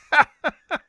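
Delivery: high cackling laugh. Real, not acted. Host cracking up at the clip's punchline, laughing. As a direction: burst out in high-pitched laughter.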